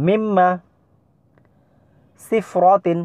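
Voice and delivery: deliberate Arabic recitation drill, syllable by syllable; Arabic letter drill. Shown as a demonstration. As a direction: call out every syllable crisply like a Qur'an teacher. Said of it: "আপনারাও কিন্তু পড়বেন".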